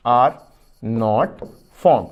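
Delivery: word by word with pauses for writing; "are", "not", "formed" dictated one by one, slowly; dictation pace. Shown as a demonstration. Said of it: "पहला point करके लिख दीजिए". ("are not formed" शब्द-शब्द रुककर लिखवाना)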